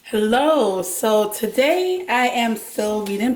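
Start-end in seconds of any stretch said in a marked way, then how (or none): none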